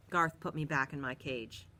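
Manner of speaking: plain reading voice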